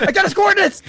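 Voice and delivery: high gleeful cry; excited shout. Acted. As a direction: crow it triumphantly. Joking threat, shouted.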